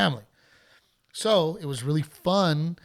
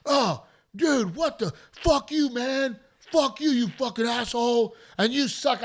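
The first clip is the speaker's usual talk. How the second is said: high, furious mock yell; mock angry husband; 8 semitones higher, slower than usual. Heckler's husband cursing at the comic as he's thrown out, high mock rage. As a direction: rage at the comic like a drunk fan. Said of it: "he's like".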